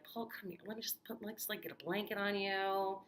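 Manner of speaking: soft, hushed coaxing with some whispering; gently calm a frightened man down; hushed, tender reassurance; soothing townsperson. Retold or imitated. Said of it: "would eventually just be like".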